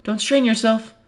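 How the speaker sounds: called out. Acted. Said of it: "Monika calls that after me".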